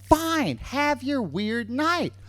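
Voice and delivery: high, slow mock giving in; grudging consent. Girlfriend succumbs to the boyfriend's pleading, grudging. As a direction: give in reluctantly, drawing it out. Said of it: "And she succumbs".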